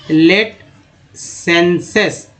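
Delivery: Senses drawn out very slowly; very slow sounding out. Shown as a demonstration. Teacher to class, very slow word demo. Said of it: "teacher sounds out word".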